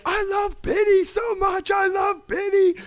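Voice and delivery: very high, loud, drawn-out squeal of adoration; hammed-up high-pitched gushing; imitated gleeful fan. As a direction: squeal it slowly like an overjoyed fan. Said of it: "prancing around with me".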